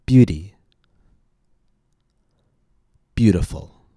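"Beauty" and "Beautiful" both stressed on the first syllable. Shown as a demonstration. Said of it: "Notice how the syllable stress stays in the same place".

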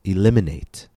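Eliminate with a loud LIM; stress before suffix. Shown as a demonstration. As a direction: punch the second syllable of Eliminate. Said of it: "the stress always falls two syllables before".

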